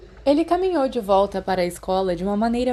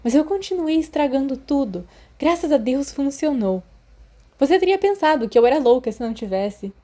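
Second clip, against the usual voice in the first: higher, chatty schoolgirl voice; eager girl; 5 semitones higher than usual. Acted. Lily explains the acetate gift to Remus, high and chatty. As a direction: chatter on proudly, a bit higher.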